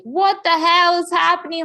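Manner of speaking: high, loud imitation of an alarmed mom; freaking out. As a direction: blurt it out in mock panic. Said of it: "freaking out".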